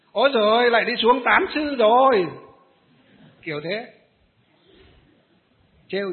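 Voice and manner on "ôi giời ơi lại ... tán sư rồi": mocking jeer. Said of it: "là chửi, là dè bỉu".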